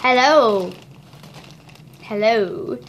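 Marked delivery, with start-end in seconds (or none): none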